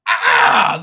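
loud unvoiced shout of rage; a raw explosive yell of frustration; real furious shout; angry shout. Real, not acted. Rage bursts mid-rant.

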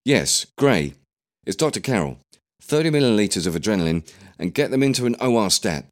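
loud, brisk mock doctor orders; commanding doctor. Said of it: "she spoke out loud".

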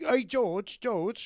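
high, mocking Cockney call; mock Cockney accent. George mocking a Cockney fan with a high Cockney voice. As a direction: lampoon a Cockney fan calling out. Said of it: "he was mocking this working class guy's accent".

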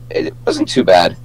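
usual speaking voice

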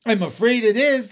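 high, loud and alarmed; fearful. A narrator's reading. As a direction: confirm the dreadful news in fright. Police chief confirms the frightening news.

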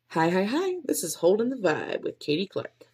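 high bouncy greeting into show name; upbeat podcast intro. Shown as a demonstration. Podcaster rehearsing her show intro, upbeat host voice. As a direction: sell it like a cheerful host. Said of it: "pretty high up there".

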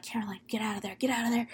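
hushed, panicky mock warning; urgent whisper. Friends urgently warn Caroline.

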